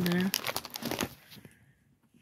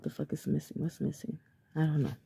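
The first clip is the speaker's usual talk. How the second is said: muttered to herself; 3 semitones lower, 7 dB quieter than usual. Real, not acted. Muttering to herself while decorating.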